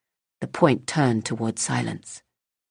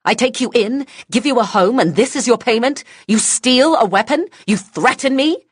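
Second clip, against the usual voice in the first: furious shout; 12 semitones higher, 7 dB louder than usual. Acted. Woman rages at child.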